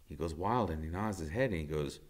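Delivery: usual speaking voice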